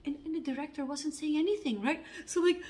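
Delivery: usual speaking voice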